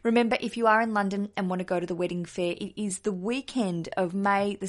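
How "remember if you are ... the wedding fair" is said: usual speaking voice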